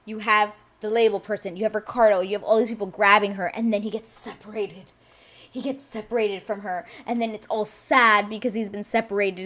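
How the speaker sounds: ordinary talk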